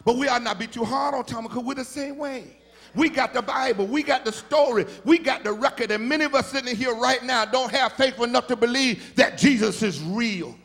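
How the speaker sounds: shouting, high, preacher's climax with Jesus's voice rising; full-force preaching. Acted. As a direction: build it to a roaring, sung-out whoop.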